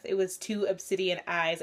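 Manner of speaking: normal talking voice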